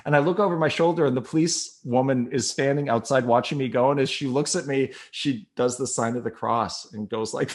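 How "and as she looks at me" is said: ordinary talk, nothing marked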